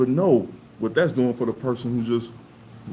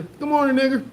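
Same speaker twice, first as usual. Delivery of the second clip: very high cheerful greeting; mock diner greeting; 5 dB louder than usual. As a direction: say the slur sweetly. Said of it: "go to the little diner".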